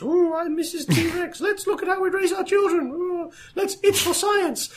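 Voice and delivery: squeaky high play-pretend dinosaur; toy dinosaur voice. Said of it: "a more high budget version of playing with dinosaur toys".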